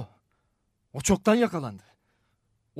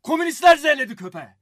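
loud, high-pitched outburst; heated shout, speeding up; angry rebuke; 4 semitones higher, 7 dB louder, faster than usual. Acted. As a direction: shut him up, then insist quickly. Angrily silences the old wrestler.

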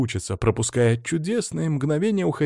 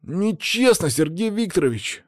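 high-pitched plea for a friend; schoolkid's objection, voiced high; indignant protest; 7 semitones higher than usual. A narrator's reading. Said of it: "вступился за друга".

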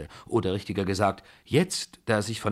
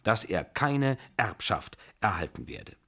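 ordinary delivery, nothing marked

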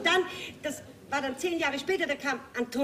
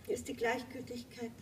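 hushed verdict; 9 dB quieter than usual. Acted. Faded close to Elisabeth, to a hush. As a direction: drop to a near-hush on the last words. Pronounces love's tragedy.

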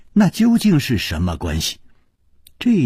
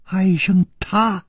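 stammering, climbing higher with shock; shaken, breathless stammer; stunned disbelief; 8 semitones higher than usual. A narrator's reading. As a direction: gasp out the questions, dying. Dying woman shocked, stammering.

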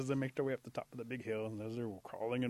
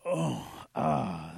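pained groans; 5 semitones lower, 9 dB louder than usual. Acted. Possum standing after the rock, groaning in pain. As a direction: groan through each ache.

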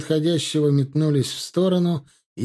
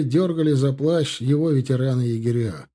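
ordinary delivery, nothing marked

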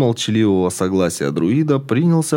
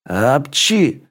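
sneeze; 4 semitones higher than usual. A narrator's reading. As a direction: sneeze yourself awake. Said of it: "очнулся Игорь".

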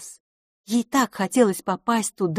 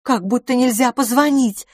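higher, louder annoyed lady; irritated; 6 semitones higher, 5 dB louder than usual. A narrator's reading. Hostess complains about banging at her door, irritated. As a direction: raise the voice in irritation at the caller. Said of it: "сказала мисс Лафос раздражённо".

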